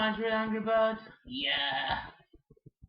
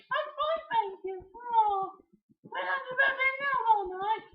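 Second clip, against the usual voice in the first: put-on much higher, light girlish voice; high princess voice. Peach answering the brothers in a high voice.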